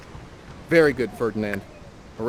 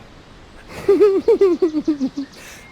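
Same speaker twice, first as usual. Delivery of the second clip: put-on much higher, loud girlish giggle; 9 dB louder than usual. Host as Miranda giggling at Ferdinand, high and coy.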